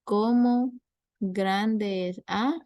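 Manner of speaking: slow, deliberate test-question reading with a lift on cómo; slow quiz reading. Shown as a demonstration. Teacher reading an exam analogy to students, slow and deliberate. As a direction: read the analogy out slowly like an exam item. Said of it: "como les dije ahorita".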